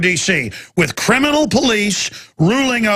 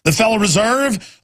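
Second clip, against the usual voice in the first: usual speaking voice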